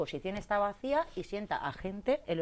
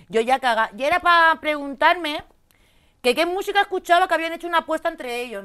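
excited telling; 10 semitones higher, 12 dB louder than usual. Real, not acted. Climax of scary story, exclaiming.

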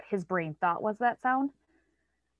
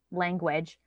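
usual speaking voice

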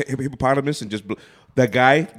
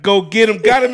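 very high, loud mock rendition; botched lyric imitation; 11 semitones higher, 7 dB louder than usual. Retelling a man bungling a song for his wife, high loud mimicry. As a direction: belt out the wrong words, laughing after.

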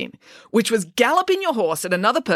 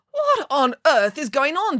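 higher, demanding; demanding pig; 8 semitones higher than usual. A narrator's reading. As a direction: demand it, startled. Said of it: "demanded Arthur".

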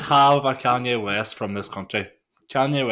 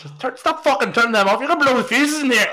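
higher, louder scolding parent voice; nagging parent; 12 semitones higher, 4 dB louder than usual. Acted. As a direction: scold like a fed-up mam.

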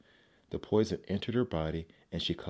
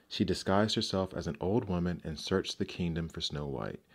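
ordinary delivery, nothing marked

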